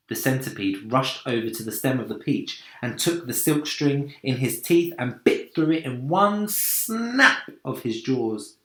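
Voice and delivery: ordinary delivery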